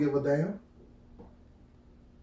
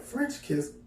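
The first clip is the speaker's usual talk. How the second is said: surprised question; 9 semitones higher than usual. Retold or imitated. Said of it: "I'm like".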